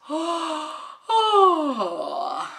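big yawned oh, rising then sighing down; yawning. Acted. Lazy Ad yawns at Job's lecture, yawning. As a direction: yawn the words out lazily. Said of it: "Ad gaapt".

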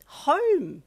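high, loud astonished cry; startled, rising outburst; gasp of surprise. Acted. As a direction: exclaim it in amazement. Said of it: "you gasped with surprise".

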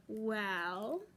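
plain reading voice

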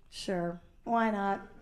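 low, hushed, tender yes; sweet soft agreement. Retold or imitated. Woman answering the lovely guy, sweetly hushed. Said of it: "asked me really sweetly".

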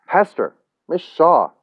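urgent, pleading hail; calling out. A narrator's reading. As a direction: call her back by name. Calling after her as she leaves.

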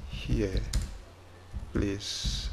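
ordinary talk

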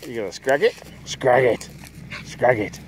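playful growly goading in short bursts; urging a pet on in play; egging on the dog. Real, not acted. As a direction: rile up a dog for a tug game.

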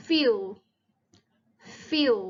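"Feel" said like fiw, final L turned to w. Shown as a demonstration. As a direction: model "Feel" with a short i gliding to w. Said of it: "คล้ายๆเสียง อิวอะค่ะ".

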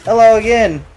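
bad, hammy Russian accent. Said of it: "was that supposed to be your Russian accent".

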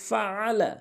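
"ফাআলা" with ain squeezed from mid-throat. Shown as a demonstration. Teacher modelling for learners, "ফাআলা" with a true throat ain. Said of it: "আইনের উচ্চারণ হলকের মাঝখান থেকে".

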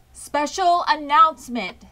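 pompous fair announcer; pompous loudspeaker. A narrator's reading. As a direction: announce it grandly over the fair speakers. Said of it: "said the loudspeaker in a pompous voice".

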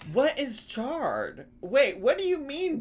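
incredulous. Real, not acted. Confused reaction.

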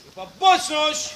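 barked parade-ground command; drill command. Acted. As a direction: shout it like a drill sergeant. Military commands to cadets.

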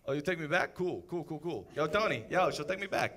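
quiet, sarcastic cool; mock cool. Dumped man brushes off her offer, quiet sarcasm. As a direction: shrug it off, fake casual.

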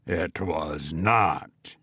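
slow, deep goblin voice. A narrator's reading. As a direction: drop it low and slow, menacing. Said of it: "said a deep voice".

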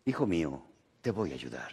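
low, soft voice from above; deep and hushed, like a voice from the sky; voice of God. Retold or imitated. God answers the hanging man, low and soft. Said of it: "se oyó una voz que dijo".

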